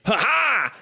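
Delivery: high, acted "ha ha"; triumphant villain laugh. Game master's knight lands a stab on the player, gloating laugh. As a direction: crow a short gloating laugh.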